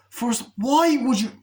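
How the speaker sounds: high, loud, drawn-out complaint; exasperated outcry. Real, not acted. Critic baffled that he follows it in, exasperated outcry.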